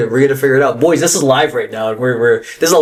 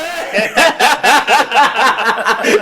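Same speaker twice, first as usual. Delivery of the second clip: laughing; 8 semitones higher than usual. Real, not acted. Laughing at the line.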